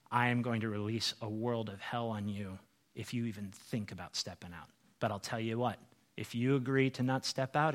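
low, quiet voice of the enemy; menacing enemy. Acted. Enemy offering a deal to make you back down, quietly menacing. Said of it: "you hear your enemy saying".

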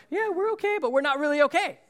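fake-fine tone that clearly isn't fine; passive-aggressive. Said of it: "and like".

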